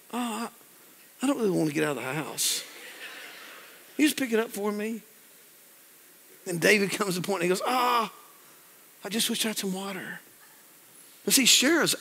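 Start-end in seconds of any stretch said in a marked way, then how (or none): 0.1-5.0 soft mock reluctance; lightly put-on coaxing, rising at the end; passive-aggressive coaxing. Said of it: "this passive aggressive thing going on". 7.6-10.2 soft, sighed wistful wish; sigh, then wish quietly; retold yearning, dropping to a soft voice; longing. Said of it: "You ever long for something?".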